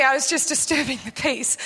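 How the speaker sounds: ordinary talk, nothing marked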